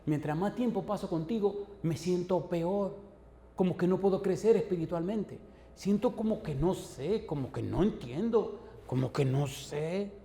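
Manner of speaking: high, drawn-out, perplexed complaint; troubled confession. Retold or imitated. Preacher retelling what he told his friend, troubled and perplexed.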